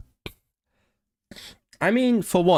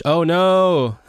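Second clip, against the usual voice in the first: higher, louder drawn-out oh no; dismayed exclamation; 7 semitones higher, 5 dB louder than usual. Real, not acted. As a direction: cry out in playful dismay. Reacts to guess about their accent.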